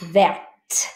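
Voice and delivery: verte said with a clear final T; T sounded. Shown as a demonstration. Teacher contrasting masculine and feminine forms. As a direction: land on the T of verte. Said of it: "but you say the T".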